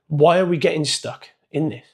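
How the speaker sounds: ordinary talk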